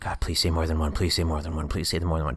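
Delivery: begging under his breath; inner pleading. Pretend. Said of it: "without the thoughts".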